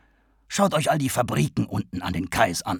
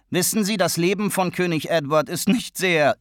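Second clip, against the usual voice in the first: streitlustig; 8 semitones higher, 3 dB louder than usual. A narrator's reading. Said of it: "sagte Mister Lyons streitlustig".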